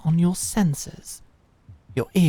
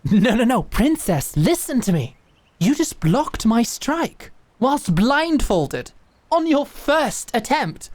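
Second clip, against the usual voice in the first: higher, louder, eager praise; excited; 5 semitones higher, 5 dB louder than usual. Acted. Mentor amazed the princess blocked blindfolded, excited. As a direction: burst with delight at her feat.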